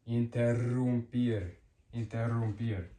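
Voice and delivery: "interrumpir" with a strong rolled rr. Shown as a demonstration. Teacher showing two R's to learners, "interrumpir" with a trilled rr. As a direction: roll the rr in "interrumpir" long and strong. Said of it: "makes it stronger and rolled".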